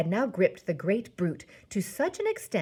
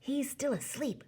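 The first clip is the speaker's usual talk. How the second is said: hushed, higher and softer; whispered; 7 semitones higher, 5 dB quieter than usual. A narrator's reading. BFG to Sophie about the sleeping giant, whispered. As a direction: whisper it softly. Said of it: "the B F G whispered".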